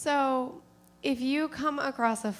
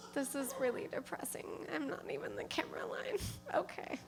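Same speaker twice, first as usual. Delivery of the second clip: muttering aside; 4 semitones higher, 9 dB quieter, faster than usual. Real, not acted. Embarrassed drunk asides.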